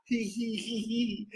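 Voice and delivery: very soft, imitated snicker; mock chuckle. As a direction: softly fake a sheepish little giggle. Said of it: "and he just smile".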